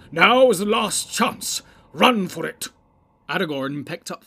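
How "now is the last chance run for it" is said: high, loud, drawn-out rallying shout; urgent shout. A narrator's reading. Wizard tells company to run for it, shouting. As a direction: shout the order to flee with full force. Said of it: "shouted Gandalf".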